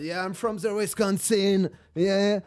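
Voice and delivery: slow, loud loud-tourist American drawl; American accent. Retold or imitated. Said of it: "je me suis dit en mode".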